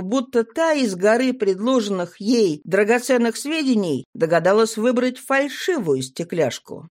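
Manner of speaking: usual speaking voice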